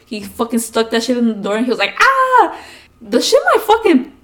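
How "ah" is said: high, loud scary yell; mock scream. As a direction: imitate dad yelling to frighten a child. Dad pushes chicken neck under door.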